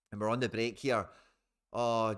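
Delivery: usual speaking voice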